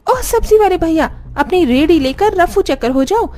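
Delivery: high, imperious sister-in-law; high-voiced, haughty brush-off; bossy dismissal. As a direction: shoo the seller off, pitched high. Sister-in-law sends the seller away.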